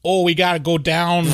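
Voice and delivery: hammed-up Chicago accent. Shown as a demonstration. Showing a co-host the local speech, Chicago accent. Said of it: "I'm saying that's a Chicago accent".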